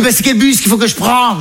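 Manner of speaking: put-on high maternal query; mother's voice. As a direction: impersonate a clueless mother's question. Mother's naive question, acted out.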